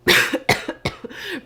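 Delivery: a real dry cough; sick cough. Real, not acted. Apologizing to viewers while still sick, coughing. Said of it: "Sorry, I am still sick".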